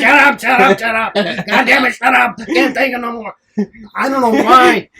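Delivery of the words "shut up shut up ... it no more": laughing, louder mock fed-up yell; mock exasperated yelling. Mocking himself yelling at a screaming baby, louder and laughing. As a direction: act out losing it at a crying baby. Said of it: "I turned into a baby killer".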